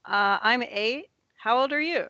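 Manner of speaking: put-on high, chirpy little-kid voice; eight-year-old child. Siobhan greets the new kid, eager child voice. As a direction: introduce yourself like an eager child, pitched high. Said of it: "Siobhan's like".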